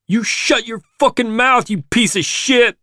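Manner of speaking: high-pitched, explosive angry yell; furious snap. A narrator's reading. Armed son cutting off his father, furious snap. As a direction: snap at full fury, pitch shooting right up. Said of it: "Claude snaps".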